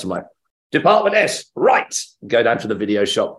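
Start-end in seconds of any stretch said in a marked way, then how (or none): 0.7-1.9 high, loud burst of enthusiasm; eager resolve. Retold or imitated. Fan hears of a show to try, eager. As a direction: seize on it like a keen fan.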